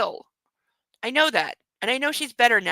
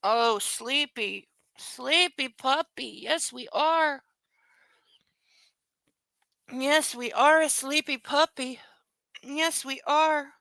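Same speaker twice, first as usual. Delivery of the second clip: slow, soft cooing to the puppy; 2 semitones higher, 4 dB quieter, slower than usual. Real, not acted. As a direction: coo gently at a sleepy pet. Baby talk to sleepy puppy.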